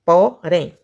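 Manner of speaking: "Porém" split into po, rém; two separate beats on "Porém"; syllable by syllable. Shown as a demonstration. Teacher showing two syllables, "Porém" broken in two. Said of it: "duas sílabas".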